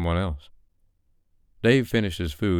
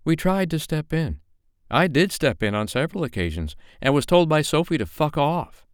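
gossiping woman; 10 semitones higher than usual. A narrator's reading. Woman explains Sophie's abuser to Dave, higher indignant. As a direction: tell it with outrage. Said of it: "with a wag of her finger".

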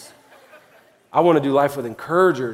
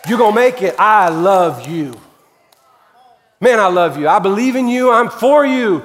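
high, loud, fervent preaching; full-force preaching; 8 semitones higher, 5 dB louder than usual. Acted. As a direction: preach it over them with fire. Pastor preaching encouragement.

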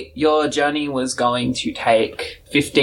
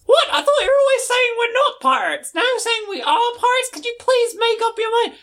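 much higher, exasperated squeak; indignant Old Smoky. Acted. Old Smoky complains to the lookout, high exasperated whine. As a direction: whine it out, fed up. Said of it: "you hear Old Smoky".

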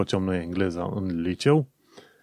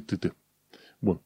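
ordinary delivery

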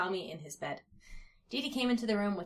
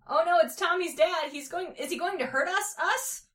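put-on high, alarmed little-kid worry; high-pitched fretful questioning; frightened cry; 9 semitones higher, 6 dB louder than usual. A narrator's reading. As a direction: cry it out, climbing higher at the end. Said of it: "cried Lil".

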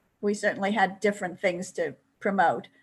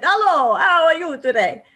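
hammed-up Italian accent, much higher and louder; strong Italian accent; 9 semitones higher, 8 dB louder than usual. Actor imitating a castmate's surprise Italian-accent entrance, hammed-up Italian accent. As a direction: belt the greeting in a big mock Italian accent. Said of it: "a very strong, like, Italian accent".

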